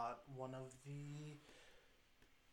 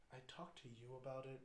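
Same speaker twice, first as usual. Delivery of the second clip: ordinary delivery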